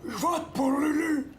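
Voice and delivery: hammed-up boxer impression, pitched high; Rocky. Colleague disguising his voice to vote for himself, bad Rocky impression. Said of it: "essaye d'imiter, euh, je sais, Rocky".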